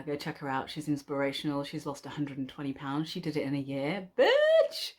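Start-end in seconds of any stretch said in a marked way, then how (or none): 4.2-4.9 high playful squeal of jealousy; mock outraged shriek. Real, not acted. Vlogger shrieking at a YouTuber's weight loss, mock outrage. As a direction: shriek it in jokey envy. Joking outburst at YouTuber's success.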